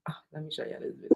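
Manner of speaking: fast unvoiced whisper. Real, not acted. Leaning in to show viewers the video, a quick whisper. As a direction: tell viewers under the breath.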